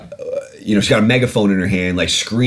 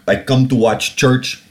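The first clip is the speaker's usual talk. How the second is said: slow, bad Russian accent; clumsy put-on Russian accent; terrible Russian accent; slower than usual. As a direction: do a hammy Russian accent, slowly. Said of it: "Terrible Russian accent".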